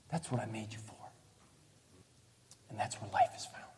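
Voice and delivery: near-whispered, tender answer; hushed God voice. Retold or imitated. God answering the doubting believer, hushed and tender. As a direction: breathe it out softly, almost unvoiced.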